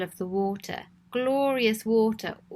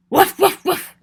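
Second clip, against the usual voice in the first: loud, higher playful woofs; barking dog; 8 semitones higher, 10 dB louder than usual. Acted. Dog surprising Cecil at the bank, loud barks. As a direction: bark it out like a big dog. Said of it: "barked the dog".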